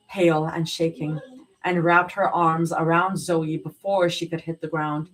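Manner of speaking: plain reading voice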